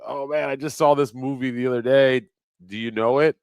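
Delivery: a bit keyed up, pushing the topic; eager chat-up. Retold or imitated. Chatting up the cashier about the movie, eager. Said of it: "I was like".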